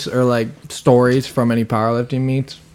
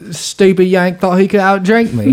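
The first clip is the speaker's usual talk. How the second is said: put-on British accent, pitched up; Russell Brand; 3 semitones higher than usual. Prankster telling his cover story to partygoers, mock British accent. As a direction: play a chatty Manchester bloke. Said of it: "you do sound like Russell Brand".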